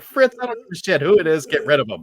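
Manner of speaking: hammed-up mad scientist snapping at Fritz; mock angry order. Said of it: "he's like".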